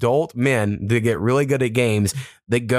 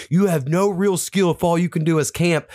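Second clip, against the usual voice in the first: mocking kid; 7 semitones higher than usual. Kids mocking camping dads.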